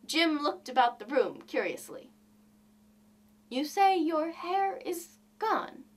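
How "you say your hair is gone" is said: slow and stunned, a little higher; dazed bewilderment. A narrator's reading. Jim stares blankly at his wife, slowly dazed. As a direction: say it as if the words won't sink in. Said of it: "with an air almost of idiocy".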